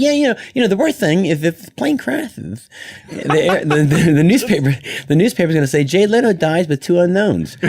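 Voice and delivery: high loud mock Leno; deadpan joke as Jay; imitating Jay Leno joking; Jay Leno impression. Jay joking about crash.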